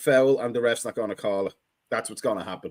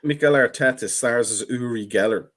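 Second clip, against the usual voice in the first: usual speaking voice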